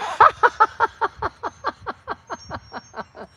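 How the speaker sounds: high, long staccato cackle; Roseanne-like cackle. Real, not acted. Streamer reads viewer's question about Americans, Roseanne-like cackle. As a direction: let out a long machine-gun laugh trailing off. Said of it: "there's the Roseanne Barr thing".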